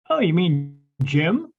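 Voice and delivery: slow, lightly voiced woman asking; a hint of the wife catching on; wife's surprised question. Medium quotes the wife realizing, slow lightly voiced surprise. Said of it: "I hear his wife go".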